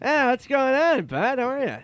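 very high, loud, effusive impression; Norm Macdonald. Norm greeting his buddy, exuberant high impression. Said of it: "he goes".

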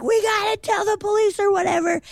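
very high, urgent mock panic; frantic teens. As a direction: blurt it in a hurry. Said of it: "they're like".

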